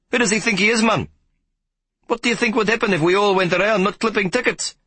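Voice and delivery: very high, indignant rant about a colleague; ranting conductor. Acted. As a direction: fume about Hoppy Groenewald.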